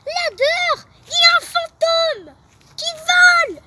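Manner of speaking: high loud panicked shout. Acted. Martin yelling to the class about a flying ghost. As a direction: yell it to the whole class. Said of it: "Martin crie".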